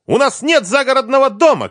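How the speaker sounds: very high, loud scream of outrage; angry yell. A narrator's reading. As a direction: yell it as an irate ex-wife. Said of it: "крикнула Лиза".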